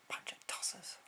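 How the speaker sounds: whispered insult under the breath; muttered whisper. Real, not acted. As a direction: hiss it aside, scornful. Whispered insult after parody.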